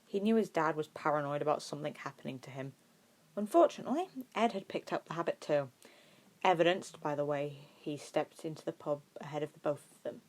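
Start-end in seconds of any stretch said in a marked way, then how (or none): none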